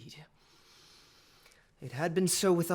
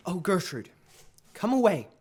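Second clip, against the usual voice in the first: ordinary talk, nothing marked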